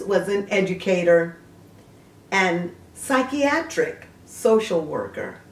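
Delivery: a bright encyclopedia read; reading a biography entry aloud; reading aloud from Wikipedia. Acted.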